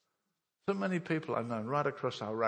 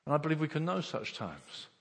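ordinary talk, nothing marked

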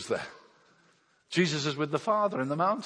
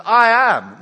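much higher and louder "I am"; booming declaration; 7 semitones higher, 17 dB louder than usual. Acted. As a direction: shout it out across the water. Jesus answers on the water.

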